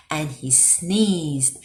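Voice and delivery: ordinary delivery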